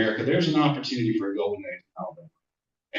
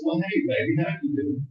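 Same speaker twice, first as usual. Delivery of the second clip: put-on sweet old teacher; 8 semitones higher than usual. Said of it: "She said".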